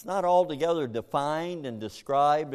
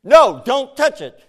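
put-on loud, slow, stern warning to a small child; parent's warning; 11 semitones higher, 15 dB louder than usual. Parent to toddler reaching for the stove, loud stern warning. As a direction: stop the toddler cold, drawn out and loud.

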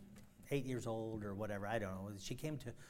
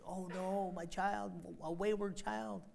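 higher, lightly lamenting; mock fretting parent; 7 semitones higher, slower than usual. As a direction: play the worried parent.

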